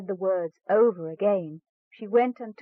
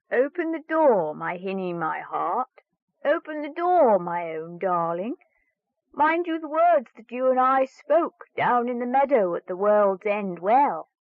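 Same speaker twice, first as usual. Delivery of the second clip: frog calling out; 4 semitones higher, 5 dB louder than usual. Acted. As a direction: call at the door like a pleading frog. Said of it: "a voice cried out".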